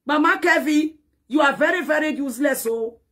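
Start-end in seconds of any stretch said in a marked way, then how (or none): none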